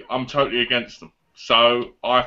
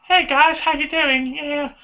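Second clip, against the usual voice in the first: much higher, squeaky mock girl voice; 11 semitones higher than usual. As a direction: tease her with a high chirpy voice. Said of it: "this is how she really talks".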